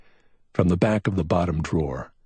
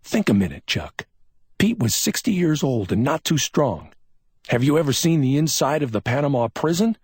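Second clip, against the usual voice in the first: a touch higher, patient reasoning; a mild hint of grim logic; lightly voiced detective; 9 semitones higher, 3 dB louder than usual. A narrator's reading. McMain reasons with Chuck about Pete, lightly voiced. McMain explains Pete's death.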